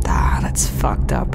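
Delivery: slow whispered remark to herself; whispered aside. Acted. Scout muttering to herself while recording her log, whispered. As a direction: breathe it out under her breath, slowly.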